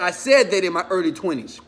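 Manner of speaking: usual speaking voice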